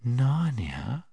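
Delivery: airy whisper, lifted at the end; sweet, quiet voice. A narrator's reading. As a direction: murmur it softly and sweetly, like a spell. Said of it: "speaking in a sweet, quiet voice".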